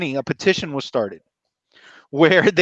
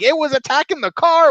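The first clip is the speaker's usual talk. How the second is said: panicked witness; 10 semitones higher than usual. Retold or imitated. Witnesses telling the town, a high mock panic. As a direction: play the terrified witness nobody believes. Couple retelling Mothman attack.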